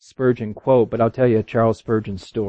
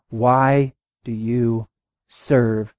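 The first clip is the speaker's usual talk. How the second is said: slow searching question; much slower than usual. Real, not acted. Rhetorical closing question to congregation.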